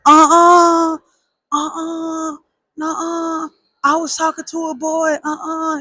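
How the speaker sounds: high, frantic denial; panicked denial. Retold or imitated. Teen lying to mom about the girl, high panicked denial. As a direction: deny it in a hurry, high-pitched.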